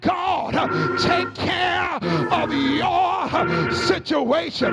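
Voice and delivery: rhythmic whooping sermon, each phrase hammered out over the organ; preaching at full force. Acted. Preacher urging the congregation to sleep and trust God, full whooping sermon. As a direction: rise into a sung preacher's cadence and drive it home.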